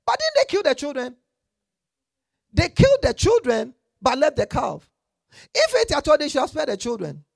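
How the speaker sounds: loud, high outraged preaching; preaching at full force. Real, not acted.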